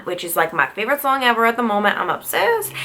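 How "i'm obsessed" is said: I'm obsessed, popped out in a random silly accent, much higher; sudden put-on accent. Real, not acted. Vlogger gushing over her favorite song, in a random put-on accent. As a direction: slip into a goofy unnamed accent for a moment. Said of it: "I just spoke with that accent".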